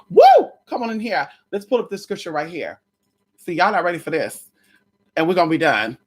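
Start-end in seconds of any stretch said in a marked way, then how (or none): 0.1-0.5 loud, high excited whoop; high woo. Real, not acted. Hyping the viewers before scripture, a loud high whoop.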